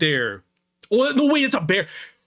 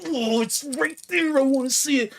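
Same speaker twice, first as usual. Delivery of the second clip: straining to hold back; 9 semitones higher than usual. Real, not acted. Streamer dying to say forbidden words, high strained whine. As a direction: squeal it as if the word is bursting out.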